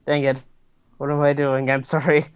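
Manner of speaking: normal talking voice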